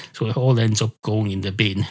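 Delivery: plain reading voice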